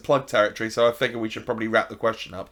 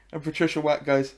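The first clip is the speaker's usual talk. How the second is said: usual speaking voice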